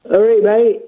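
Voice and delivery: funny voice. Pretend. Caller hiding who he is from the host, funny voice. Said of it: "is that [name] doing a funny voice?".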